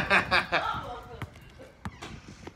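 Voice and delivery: can't stop laughing at the replay; high laughing fit. Real, not acted. Reacting to the clip, a high laughing fit.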